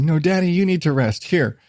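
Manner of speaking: usual speaking voice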